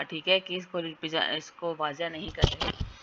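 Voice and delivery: ordinary delivery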